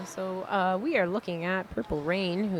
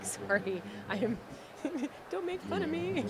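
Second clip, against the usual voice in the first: laughing apology; 9 semitones higher, 4 dB quieter than usual. Retold or imitated. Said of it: "I was like".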